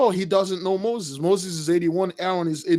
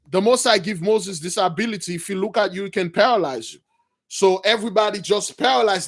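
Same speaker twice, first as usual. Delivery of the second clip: usual speaking voice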